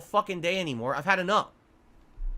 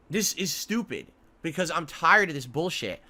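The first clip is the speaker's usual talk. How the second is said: usual speaking voice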